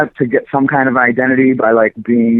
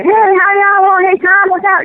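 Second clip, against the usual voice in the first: much higher, friendly greeting voice; mock greeting from childhood friends, pitched way up; imitating childhood friends; 3 dB louder than usual. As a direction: greet Tom like old neighborhood kids. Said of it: "they were like".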